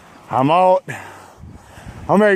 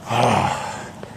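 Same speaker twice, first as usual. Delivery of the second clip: heavy sigh; 7 semitones lower, 4 dB quieter than usual. Real, not acted. Exhausted cyclist venting on a hill.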